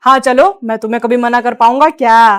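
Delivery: doting husband. Acted. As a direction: play the indulgent man of the house.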